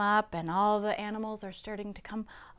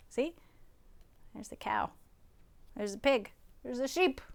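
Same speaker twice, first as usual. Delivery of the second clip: ordinary delivery, nothing marked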